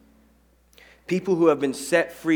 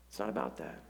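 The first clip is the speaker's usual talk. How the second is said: much softer, falling repeat; soft echo; 6 semitones lower, 11 dB quieter, faster than usual. Shown as a demonstration. Pastor quietly repeating the point to the church. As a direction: drop it low to drive it home.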